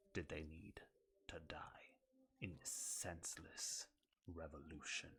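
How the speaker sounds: quiet, bitter villain speech. Acted.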